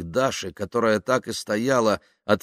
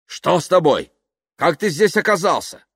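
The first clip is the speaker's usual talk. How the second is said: loud, high, exasperated questions; agitated shouting; 10 semitones higher, 6 dB louder than usual. Acted. Yuklin demanding how Kaftanov got here, agitated shouting. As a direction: fire the questions in alarm.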